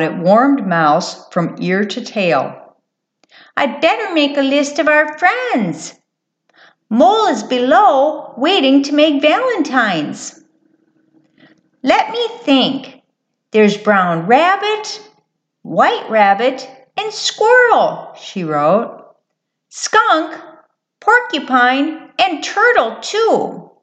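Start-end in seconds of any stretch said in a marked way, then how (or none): 3.6-18.1 high-pitched mouse character voice; eager little mouse. Acted. Voices Mouse making a list. 19.7-23.7 high, chirpy mouse voice; little mouse listing. Acted. Mouse goes on with list.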